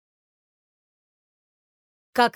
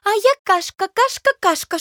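much higher, louder boastful porridge; porridge running over; 11 semitones higher, 8 dB louder than usual. A narrator's reading. Porridge crawling onto the stove, much higher and louder. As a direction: crow it as you boil over. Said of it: "а сама всё повторяет".

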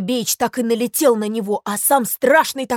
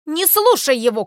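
loud, higher-pitched protest; raised urgent call; shouting out; 5 semitones higher, 5 dB louder than usual. Acted. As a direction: yell up to someone from the water. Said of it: "крикнул трубочисту из воды Ёрш Ершович".

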